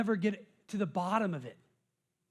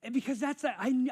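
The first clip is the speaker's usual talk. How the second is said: ordinary delivery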